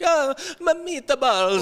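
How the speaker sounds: high, slow cartoon Italian accent; drawn-out, high mock-Italian cry; Luigi. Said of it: "Luigi, like the Italian cook".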